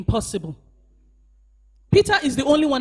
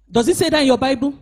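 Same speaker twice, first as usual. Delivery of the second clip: ordinary talk, nothing marked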